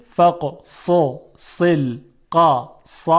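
teacher breaking فقصص القصص into separate beats, each ص distinct; slow, segmented فقصص القصص; careful pronunciation demo. Shown as a demonstration. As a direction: sound out فقصص القصص syllable by syllable, very slowly. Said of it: "خب، دقت کنید".

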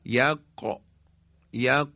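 "یق" with q at the tongue root; careful demo of uvular q in "یق"; backed qaf. Shown as a demonstration. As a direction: pull the final q of "یق" right back.

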